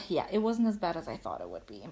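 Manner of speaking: ordinary talk, nothing marked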